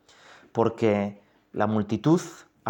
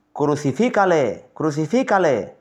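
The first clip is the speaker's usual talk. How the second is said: crowd crying out; 4 semitones higher, 4 dB louder than usual. A narrator's reading. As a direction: call it out like a mob. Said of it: "volvieron a dar voces".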